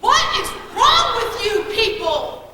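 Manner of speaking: loud, heavily punched telling-off; exasperated scolding. Acted. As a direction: scold the whole room like misbehaving children. Scolds the whole group.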